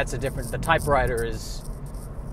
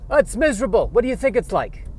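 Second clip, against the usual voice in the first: put-on high, loud Yiddish-accented squirrel; 8 semitones higher, 5 dB louder than usual. Squirrel character complains.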